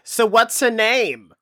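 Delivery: mock Australian asking a name; Australian accent. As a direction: put on an Aussie voice, nosy and quick. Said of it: "Is that your Australian accent?".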